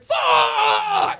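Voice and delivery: high, loud, stretched scream; imitated panicked yell. Comic voicing cut-off driver, screaming curse. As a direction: mimic a driver about to crash. Retold driver nearly crashing.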